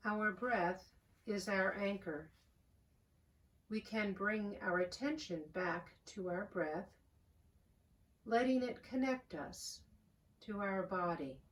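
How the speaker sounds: calm, unhurried voice with long pauses; soothing prayer-meditation delivery, phrase by phrase; guided meditation. Real, not acted. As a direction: guide them gently into the breath, softening as you go. Leads a guided breathing meditation.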